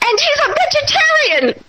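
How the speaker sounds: replaying her own tearful panic at the neighbor's door; hysterical. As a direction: squeak it out in tearful disbelief. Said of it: "I'm, like, all hysterical".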